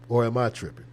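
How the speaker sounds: usual speaking voice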